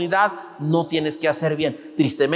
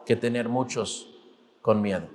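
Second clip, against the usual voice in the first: hushed low ending; 8 semitones lower, 8 dB quieter than usual. Acted. Sermon point sinking low.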